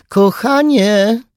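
high, loud, slow pleading mother's call. Acted. Mother calling her daughter from the corridor, high and pleading. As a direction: call sweetly through the door. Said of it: "zawołała z korytarza mama".

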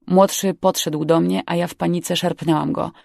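ordinary delivery

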